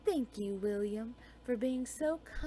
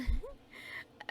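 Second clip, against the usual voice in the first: giggle; 4 semitones higher than usual. Acted. Giggles about the blue dress.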